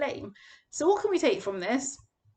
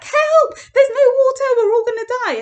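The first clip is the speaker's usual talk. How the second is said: much higher and louder, a frantic mob yelling; panicked crowd shout; 11 semitones higher, 12 dB louder than usual. Retold or imitated. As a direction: cry out like a thirsty crowd sure they will die.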